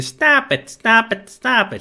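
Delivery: high-pitched, repeated protest; acted high, urgent scolding; Miss Ellie. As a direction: cry out in a high voice to break up a squabble. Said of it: "Miss Ellie now enters saying".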